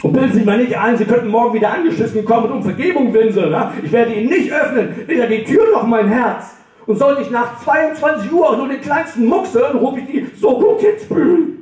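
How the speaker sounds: high, building indignant tirade; pompous furious warning, pitch rising to the end; escalating threat. Acted.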